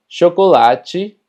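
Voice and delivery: chocolate slowly, ending in "chi"; TE pronunciado chi. Shown as a demonstration. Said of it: "T E se pronuncia como chi". (chocolate lento, con final en «chi»)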